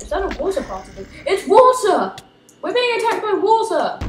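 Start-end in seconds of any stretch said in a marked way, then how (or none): 1.3-4.0 high, loud mock alarm; mock panic. Real, not acted. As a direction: cry out in playful panic.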